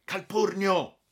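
high loud warning cry; boy warning the others the director is coming, alarmed; alarmed exclamation. Acted. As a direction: shout the alarm signal. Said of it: "il Del Ponte esclamò".

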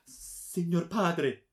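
high, loud, drawn-out imploring; desperate, sorrowful plea. A narrator's reading. Daughter on her knees to her father, desperate pleading. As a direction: beg slowly in anguish, pitched high. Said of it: "un'espressione disperata di preghiera e di dolore".

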